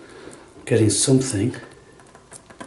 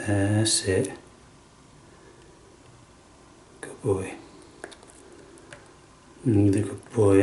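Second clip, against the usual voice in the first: soft praise; 3 semitones lower, 8 dB quieter than usual. Real, not acted. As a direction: praise him gently, almost murmured. Praising pet softly.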